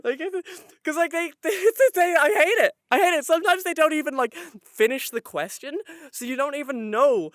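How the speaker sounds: worked-up, high, stumbling rant; genuinely frustrated venting; real frustration. Real, not acted. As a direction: sputter with exasperation, pitch up and loud. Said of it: "I'm getting frustrated".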